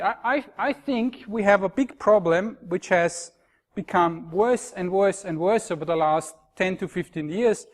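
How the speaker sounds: ordinary talk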